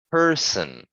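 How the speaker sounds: "person" with strong, high er, then falling; stressed er. Shown as a demonstration. Teacher showing stressed er, "person" with weight on er. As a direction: lean into the er of "person". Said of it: "putting more emphasis on the er".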